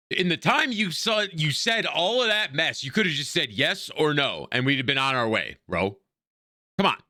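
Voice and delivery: fed-up, incredulous griping up high; exasperated. Real, not acted. Player griping at long-winded game dialogue.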